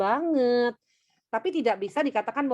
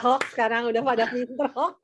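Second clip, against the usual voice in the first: laughing. Real, not acted. Teacher laughs at class answer.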